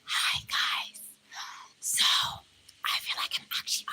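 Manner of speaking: unvoiced, breathy whispering; ASMR whisper. Shown as a demonstration. Streamer demonstrates ASMR greeting to her viewers, whispered. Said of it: "A S M R type voice".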